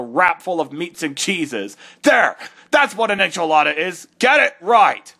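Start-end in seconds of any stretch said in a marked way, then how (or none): 2.0-5.1 angry shout. Acted. Angry correction.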